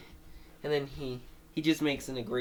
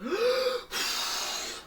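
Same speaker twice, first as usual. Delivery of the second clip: sharp inhale, then a loud blow; big breath in, blown out; 3 dB louder than usual. Shown as a demonstration. Showing how characters blow the tanks away, big breath out.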